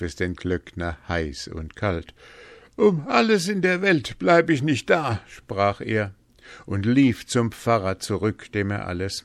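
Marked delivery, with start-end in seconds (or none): 2.8-5.2 high, loud, alarmed refusal; frightened refusal. A narrator's reading. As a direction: refuse in a panic, pitch jumping up. Terrified sexton refuses to stay.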